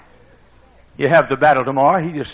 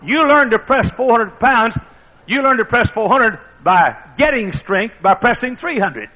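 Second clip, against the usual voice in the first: higher, louder preacher's push; preaching at full force; 8 semitones higher, 5 dB louder than usual. Acted. Preacher pressing the lesson, high and loud. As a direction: drive the point home loudly.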